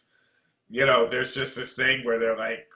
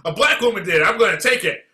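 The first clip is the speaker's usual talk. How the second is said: higher and louder, a mock excited convert; mocking gullible people hyped to take the vaccine, higher and louder; 7 semitones higher, 7 dB louder than usual. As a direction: ham up a sudden believer, higher and louder. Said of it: "so now we're supposed to be".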